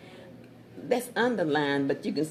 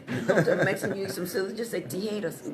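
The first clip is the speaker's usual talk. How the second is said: normal talking voice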